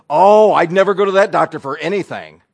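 loud, high, preachy advice; a busybody pushing remedies on the widow; bossy know-it-all. Retold or imitated. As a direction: lecture them like you know best.

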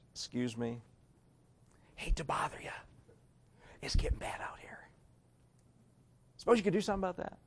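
soft whispered polite request; calm polite whisper. Retold or imitated. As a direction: lean in and whisper it politely, no panic. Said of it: "calmly walk over and say".

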